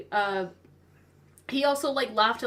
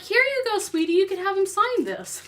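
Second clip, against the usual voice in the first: sweet motherly voice; 10 semitones higher, 5 dB louder than usual. Retold or imitated. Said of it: "she's like".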